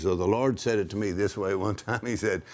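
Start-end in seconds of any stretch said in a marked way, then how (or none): none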